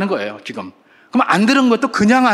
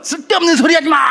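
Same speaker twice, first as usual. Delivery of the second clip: high, loud crowd yell; angry mob shouting at Stephen; 10 semitones higher, 4 dB louder, faster than usual. Retold or imitated. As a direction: yell to drown him out. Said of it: "막 이러면서".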